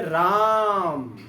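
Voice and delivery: राम with the आ held three times long, loud and slow; prolonged pluta vowel. Shown as a demonstration. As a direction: call out राम, stretching the vowel. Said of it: "कंपन करके निकल रहा है राम". (राम को तिगुना खींचकर ज़ोर से बोलना)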